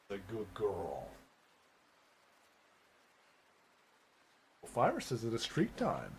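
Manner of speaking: soft cooing to a pet. Real, not acted. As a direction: coo softly to a dog. Cooing to her dog.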